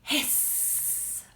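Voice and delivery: long drawn-out s on "hiss"; snake hiss. Pretend. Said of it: "Make a big hiss".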